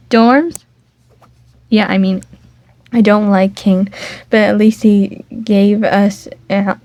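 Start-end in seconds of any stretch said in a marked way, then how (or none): none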